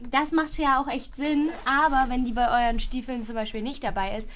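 ordinary talk

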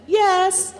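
a high, bright, excited yes; eager yes. Retold or imitated. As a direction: leap at the offer with a delighted yes.

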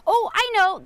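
normal talking voice

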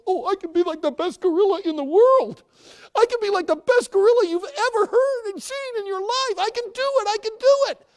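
very high, loud squeaky bragging; eager clown. Acted.

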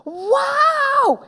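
high, drawn-out wow; awestruck. Acted. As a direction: gasp it in thrilled amazement. Henry thrilled by the shrunken heads.

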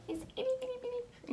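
high, soft and quick; tiny squeaky voice for a tiny seed; itty bitty voice. Pretend.

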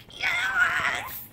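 very high, loud cheering yes; triumphant yell. Retold or imitated. Fan cheering as the heroes collide, a high triumphant yell. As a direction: scream it like your team scored.

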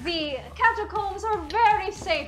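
put-on Italian tour guide with an accented, lilting delivery; halting, foreign-accented local guide; Massimo. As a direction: play a reassuring catacomb guide. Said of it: "my name is Massimo".

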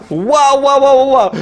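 rapid run of wows; amazed. Real, not acted. Collector reacting to the cards just pulled, amazed.

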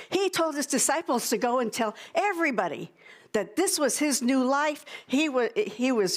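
usual speaking voice

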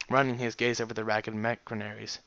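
ordinary delivery

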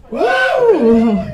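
ordinary talk, nothing marked

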